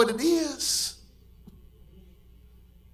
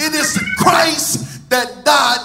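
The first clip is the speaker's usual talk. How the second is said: louder sung preaching cadence; full-force preaching; 6 dB louder than usual. Acted. Preacher rouses the congregation, full-force preaching. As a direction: preach it out to a sleepy church.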